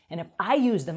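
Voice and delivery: ordinary delivery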